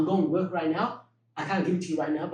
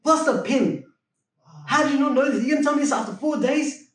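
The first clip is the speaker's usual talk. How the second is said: friend's angry rant; 6 semitones higher, 5 dB louder than usual. Retold or imitated. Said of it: "He got so angry".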